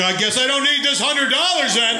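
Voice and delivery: put-on a young guy realizing dinner is off, laughing; resigned quip. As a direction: give up the cash with a laughing shrug. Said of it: "I was like".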